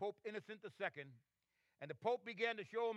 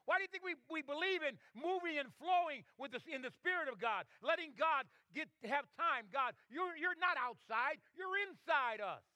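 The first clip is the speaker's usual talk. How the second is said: raised, driving pulpit delivery; fervent preaching; 6 semitones higher, 4 dB louder than usual. Real, not acted. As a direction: preach it hard, stretching the final contrast. Preaches at full force.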